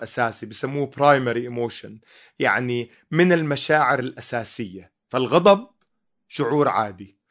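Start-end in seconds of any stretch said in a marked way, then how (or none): none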